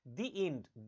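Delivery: "The" said "dee" before end. Shown as a demonstration. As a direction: say "The" with a long ee. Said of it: "दी बोलेंगे".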